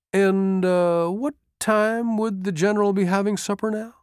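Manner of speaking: high, tentative aide's question; hopeful, hesitant hint to a superior; hinting sergeant. A narrator's reading. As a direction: ask delicately, pitched well up. Sergeant hints about supper.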